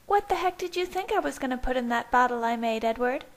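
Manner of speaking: high, quick sarcastic female character. A narrator's reading. Rosalie mocks his shock over the bottle, sarcastically. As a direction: needle him in a high, mocking voice. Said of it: "she thought sarcastically".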